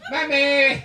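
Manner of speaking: high, loud call for mother; calling for mammy. Retold or imitated. Son calling his mother for help, loud call. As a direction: yell for mum from the toilet. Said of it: "and you go".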